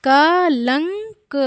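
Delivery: कलंक drawn out with nasal ng on लं; slow careful demo. Shown as a demonstration. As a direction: say कलंक very slowly, syllable by syllable. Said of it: "अनुस्वार लगा हुआ है ला पे".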